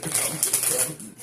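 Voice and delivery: loud, laughing delivery; amused and loud, real laugh; laughing disclaimer. Real, not acted. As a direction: laugh right through the words. Laughs at her own disclaimer.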